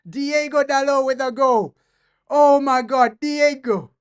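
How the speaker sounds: high loud joyful shouting; excited cheering. Real, not acted. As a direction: cheer the scorer loudly. Celebrating the goal.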